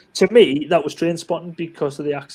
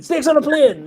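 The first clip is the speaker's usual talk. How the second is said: mock eager answer; 9 semitones higher, 3 dB louder than usual. Mocking a wrong quiz answer.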